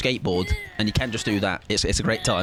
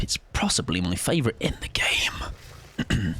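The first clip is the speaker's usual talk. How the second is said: soft unvoiced aside; whisper; 6 semitones lower, 4 dB quieter, slower than usual. Real, not acted. Streamer confiding to viewers, whispered. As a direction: whisper it like a secret.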